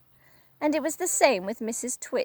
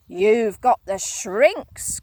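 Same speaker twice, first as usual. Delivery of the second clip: slow, louder accusing cry; Mister Twit crying; 6 dB louder, much slower than usual. Acted. Husband accusing his wife, slow loud cry. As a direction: point the words like a pistol. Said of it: "cried Mister Twit".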